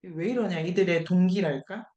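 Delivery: usual speaking voice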